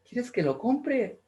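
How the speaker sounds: doting father offering to buy; indulgent father. Retold or imitated.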